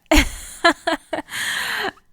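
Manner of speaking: high, loud laugh; a real laugh at her own slip. Real, not acted. Laughs after correcting herself.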